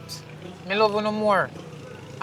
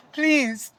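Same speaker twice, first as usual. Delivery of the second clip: tearful plea; 8 semitones higher than usual. Acted. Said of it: "Mommy, you don't need to cry".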